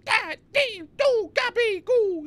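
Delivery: very high, strained mock scream; mock gamer yelling. Streamer imitating himself dying in a video game, high screechy yell. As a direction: screech like you just died in game. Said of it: "yelling and stuff".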